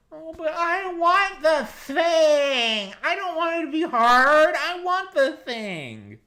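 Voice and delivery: very high, slow whining with a sob; whiny millennial. Retold or imitated. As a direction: whimper like a spoiled child. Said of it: "It's like".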